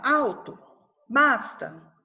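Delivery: ordinary talk, nothing marked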